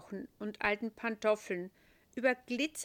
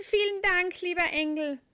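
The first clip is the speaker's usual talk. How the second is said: calling out; 10 semitones higher, 9 dB louder than usual. A narrator's reading. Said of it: "ruft er".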